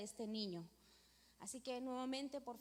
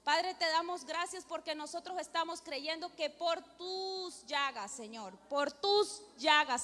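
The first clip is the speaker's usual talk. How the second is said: pleading prayer building louder and higher; fervent prayer; 3 semitones higher, 4 dB louder than usual. Real, not acted. Woman praying to God for a sick child's healing, fervent building prayer. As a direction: pray aloud with mounting force, phrase on phrase.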